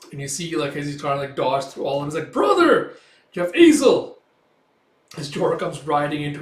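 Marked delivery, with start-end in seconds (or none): 2.3-4.2 very high, loud cries; acted shout to a sibling; alarmed calling out. Rider races to rescue brother, alarmed cries.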